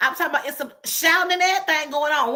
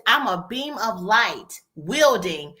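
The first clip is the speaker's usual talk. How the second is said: slow proclamation; 4 semitones lower, slower than usual. Retold or imitated. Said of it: "She says".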